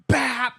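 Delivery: loud, high punch sound; sudden loud mock smack; imitated punch noise. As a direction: pop it out like a fist landing. Imitates mom's punch landing.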